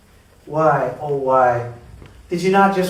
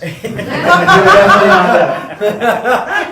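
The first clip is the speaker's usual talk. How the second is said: laughing; 7 semitones higher, 6 dB louder, much slower than usual. Real, not acted. Teller cracking up at own story.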